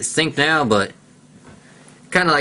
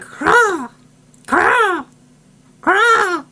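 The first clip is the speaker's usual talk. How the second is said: much higher cawing birdcall; crow call imitation; much slower than usual. Kid imitating a crow for viewers, high caw. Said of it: "They're like".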